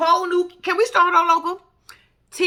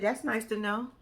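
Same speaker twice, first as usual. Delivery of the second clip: mimicking his flat, low, quiet reply; deadpan low mumble, unimpressed; flat deadpan mimic; 4 semitones lower, 10 dB quieter than usual. Mocking the husband's cold reply, low and deadpan. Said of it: "That man said".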